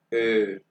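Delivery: ए said as German "ö", lips rounded; German umlaut demo. Shown as a demonstration. Teacher shows O with two dots, long ö. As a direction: stretch ए into a rounded "öö". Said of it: "ओ को कहते हैं ए".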